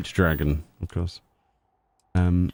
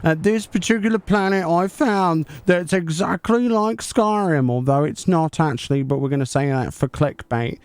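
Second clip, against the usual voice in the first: higher, bright-eyed 'hi guys' YouTuber hype; hammed-up YouTuber intro voice, pitched up; parody YouTuber voice; 5 semitones higher than usual. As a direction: play an over-eager clickbait YouTuber, guys after every phrase. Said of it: "We'll make our own Easter egg video then".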